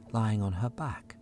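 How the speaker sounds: ordinary delivery, nothing marked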